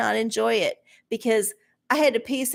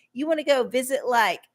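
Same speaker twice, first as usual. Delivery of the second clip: ordinary talk, nothing marked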